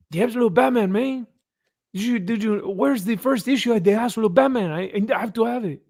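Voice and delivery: high-pitched, hammed-up South American Spanish accent; mock Spanish accent. Imitating a customer desperate for a first issue, mock Spanish accent. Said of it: "more like a South American type Spanish".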